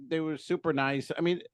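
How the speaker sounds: normal talking voice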